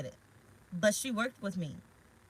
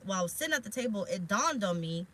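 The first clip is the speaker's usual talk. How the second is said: ordinary talk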